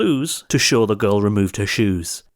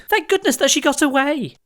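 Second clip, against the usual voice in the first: put-on children cheering. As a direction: squeal it like delighted kids.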